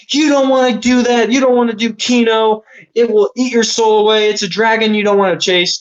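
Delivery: imitating a panicked girlfriend begging him not to; mock freaking out. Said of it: "his girlfriend starts freaking out".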